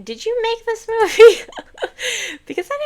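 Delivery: high, loud teasing question that breaks into laughter; laughing tease. Acted. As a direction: tease her and crack up laughing.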